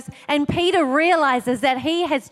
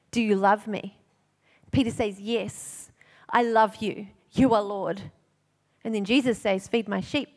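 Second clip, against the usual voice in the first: soft tender preaching; 5 semitones lower, 4 dB quieter than usual. Real, not acted. Tender climax of the sermon.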